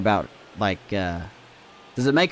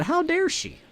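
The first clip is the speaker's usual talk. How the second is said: high, indignant how dare she; mock offended squeak; mock outrage; 13 semitones higher, 4 dB quieter than usual. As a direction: play at being offended.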